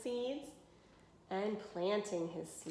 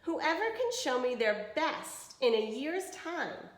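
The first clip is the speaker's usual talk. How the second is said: clear, even storybook reading; reading to children; 5 semitones higher than usual. Acted. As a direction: read it to a class of kids. Reads picture book to class.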